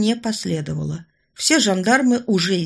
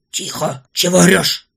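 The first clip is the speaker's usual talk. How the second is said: sharp, louder angry rebuke; angry hush; 6 dB louder than usual. Acted. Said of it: "разозлился майор".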